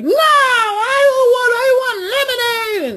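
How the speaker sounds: put-on high, loud, slow crying child; toddler wail. Son in the truck demanding lemonade, high crying wail. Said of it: "And he's like".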